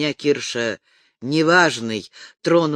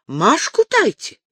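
put-on much higher, mumbled old Tatar trader with broken Russian; a Tatar man muttering in a much higher voice; 12 semitones higher, 4 dB louder than usual. A narrator's reading. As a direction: mumble it high and eager like the Tatar horse-trader. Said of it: "бормотал татарин".

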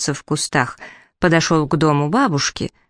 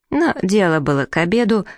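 plain reading voice

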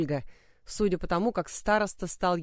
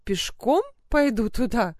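indignant; 2 semitones higher, 4 dB louder than usual. A narrator's reading. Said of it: "возмущённо проговорила она".

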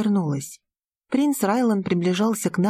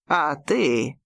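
sarcastic; 6 semitones lower than usual. A narrator's reading. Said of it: "язвительно спросил мой отец".

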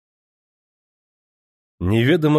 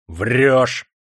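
a sharp louder yell; 2 semitones higher, 4 dB louder than usual. A narrator's reading. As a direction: throw the accusation at him. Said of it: "завопил оберпрокурор". (громкий окрик)

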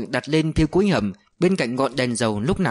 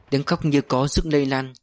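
ordinary delivery

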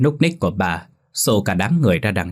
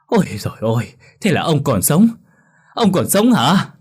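manic laughter; 6 semitones higher, 4 dB louder than usual. Acted. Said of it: "bà cười phá lên như tâm thần".

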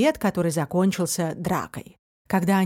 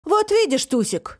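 raised, pointed announcement; deliberately loud voice; 7 semitones higher than usual. A narrator's reading. Фантик winks and addresses Тусик, pointedly loud. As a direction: say it for the eavesdropper's benefit. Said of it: "сказал специальным громким голосом".

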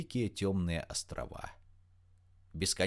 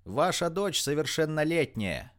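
higher, raised voice calling up; shouted retort; 6 semitones higher, 4 dB louder than usual. A narrator's reading. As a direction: shout back at the mother. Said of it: "крикнула разбушевавшейся женщине Рита".

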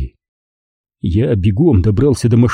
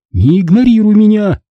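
high, louder demand; 10 semitones higher, 4 dB louder than usual. Acted. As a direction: demand he look at her. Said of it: "потребовала она". (мать требует, чтобы сын её слушал)

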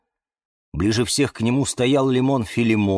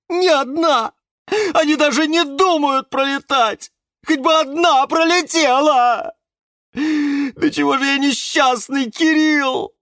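hammed-up loud, high wailing self-pity; sobbing; 6 dB louder than usual. A narrator's reading. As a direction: wail tearfully as if utterly wretched. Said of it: "вдруг всхлипнул Жорж".

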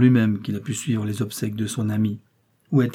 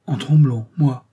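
alarmed cry; 2 semitones higher, 5 dB louder, slower than usual. Acted. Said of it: "s'écria-t-il, alarmé".

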